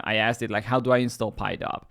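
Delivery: usual speaking voice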